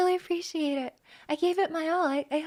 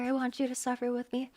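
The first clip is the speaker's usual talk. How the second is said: normal talking voice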